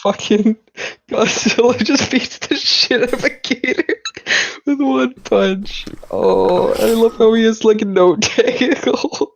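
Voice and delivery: high, laughing excitement; excited. Real, not acted. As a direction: squeal with delight at it. Excited laughing at Godzilla image.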